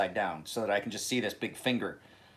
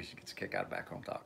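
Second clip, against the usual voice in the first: much softer, nearly breathed; a soft aside about his sister who loves back home talk; whispered aside; 11 dB quieter, faster than usual. Real, not acted. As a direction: drop the voice for a private aside.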